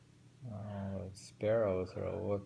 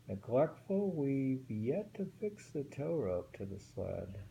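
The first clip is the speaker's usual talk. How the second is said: poem reading; 3 semitones higher than usual. Acted. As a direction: lift the first word, then settle into the poem. Rereads the same haiku.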